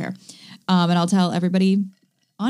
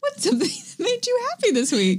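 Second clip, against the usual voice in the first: much higher, sing-song host voice; high-pitched. Acted. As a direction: ask it like a bright game show host. Asks the question a third time.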